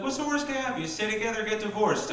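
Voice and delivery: high soft mocking voice; mock people voice. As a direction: mimic naive people, high.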